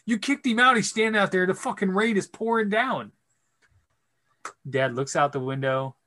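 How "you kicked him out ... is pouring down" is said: high, protesting retold plea; indignant reproach. Son reproaching dad about the customer in the rain, indignant. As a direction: protest on someone's behalf, pitched up.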